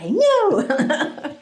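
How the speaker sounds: high, giggly enthusiasm; shared glee. Retold or imitated. Security man sharing joy over scanners, gleeful. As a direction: agree as if equally thrilled.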